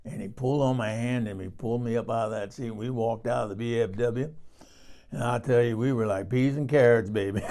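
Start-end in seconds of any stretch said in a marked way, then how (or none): none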